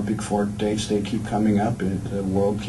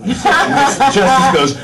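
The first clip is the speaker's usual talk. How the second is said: laughing; 9 dB louder, much slower than usual. Real, not acted. Laughing while retelling son's line.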